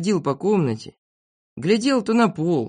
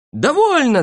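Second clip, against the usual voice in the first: high, loud cry of protest; shouting; 9 semitones higher, 5 dB louder than usual. A narrator's reading. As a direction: shout to put a stop to it. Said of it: "закричали все". (громкий протестующий крик)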